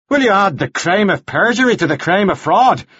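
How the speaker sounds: high-pitched indignant challenge; outraged reverend. A narrator's reading. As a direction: challenge her as an outraged clergyman. Devlin challenges Anna in quarrel.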